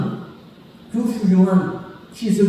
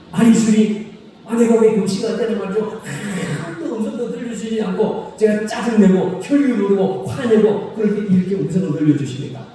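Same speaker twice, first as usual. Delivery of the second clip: higher, whiny protest to God; mock complaining; 5 semitones higher than usual. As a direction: sulk at God like a wronged believer. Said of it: "이 사람이 이렇게 말합니다". (주님께 투정하듯 높은 목소리로 항의)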